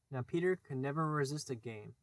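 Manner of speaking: usual speaking voice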